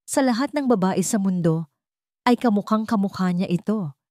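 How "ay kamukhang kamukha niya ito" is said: usual speaking voice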